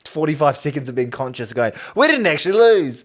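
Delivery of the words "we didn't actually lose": high, loud mock celebration; elated relief. Fan fist-bumping on waking from the nightmare, elated relief. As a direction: cheer it out in disbelief.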